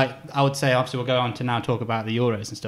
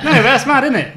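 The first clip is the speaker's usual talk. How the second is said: surprised; 12 semitones higher, 9 dB louder than usual. Real, not acted. Reacting to non HD memory.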